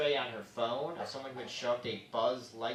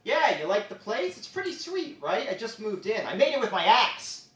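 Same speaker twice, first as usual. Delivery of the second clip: higher, lightly louder chummy host; spider impression; 8 semitones higher, 5 dB louder than usual. Spider proudly showing off his home, higher. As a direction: show off the new web like a proud dude. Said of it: "got another fun impression".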